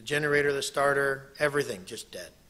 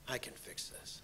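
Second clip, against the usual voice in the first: soft whispered promise; god promising to fix you; tender reassurance; 3 semitones lower, 18 dB quieter than usual. Pretend. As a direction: gently reassure. God speaks grace.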